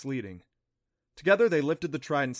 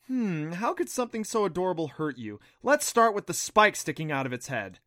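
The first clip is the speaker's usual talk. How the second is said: sarcastic retort; 5 semitones higher, 5 dB louder than usual. A narrator's reading. Adam mocking her words sarcastically.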